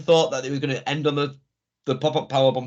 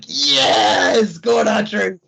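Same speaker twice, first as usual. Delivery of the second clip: louder, higher cheering release; relieved crowd cheer; 4 semitones higher, 5 dB louder, much slower than usual. Retold or imitated. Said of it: "it's like".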